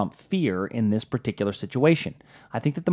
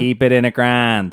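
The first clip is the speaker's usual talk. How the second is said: broad Pittsburghese, "ground" flattened; Pittsburgh accent; 4 dB louder than usual. Shown as a demonstration. Insisting over partner's protests, broad Pittsburgh accent. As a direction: say it like a proud Pittsburgh local. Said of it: "I hate that accent".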